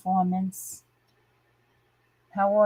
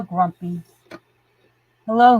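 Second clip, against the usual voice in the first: ordinary delivery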